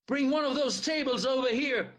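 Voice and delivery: high, urgent call across the room; rally everyone with a shouted order. A narrator's reading. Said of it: "Theo shouted".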